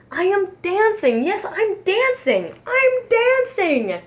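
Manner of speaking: high, delighted shouts; bursting with joy at dancing; joyful. Acted. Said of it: "Gerald cried for joy".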